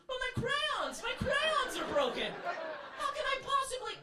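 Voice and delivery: whiny tenant. Acted. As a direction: plead like a big baby. Tenant whining to super.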